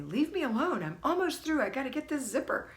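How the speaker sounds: a retold grumpy "leave me alone", hurried at the end; grumpy retort. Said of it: "I would be grumpy and say".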